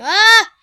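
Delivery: sudden loud high yelp of alarm; startled yelp. Real, not acted. Gamer yelps at the screen in startled surprise. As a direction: yelp as the game suddenly goes wild.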